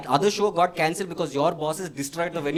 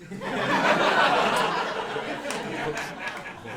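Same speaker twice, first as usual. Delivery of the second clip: exaggerated high gasp; big theatrical intake of breath; shocked gasp; 9 semitones higher than usual. Shown as a demonstration. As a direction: gasp in mock horror at the amount. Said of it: "I did this".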